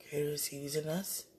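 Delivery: ordinary talk, nothing marked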